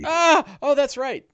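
high yelp into high-pitched realisation; panicked Bing voice. Acted. Bing told to heal himself, high-voiced sudden realisation. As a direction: cry out, then remember in the same high voice.

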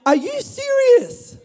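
very high, slow disbelief; exaggerated Jesus disbelief; incredulous. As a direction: stretch it out, high and amazed. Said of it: "And Jesus is saying".

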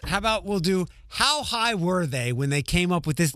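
ordinary delivery

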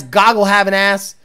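normal talking voice

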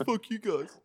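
high, pinched grumpy voice; grumpy demon. Retold or imitated. Shrunken demon snapping at the others, high and grumpy. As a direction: spit it out like a sulking little demon. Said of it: "it's like".

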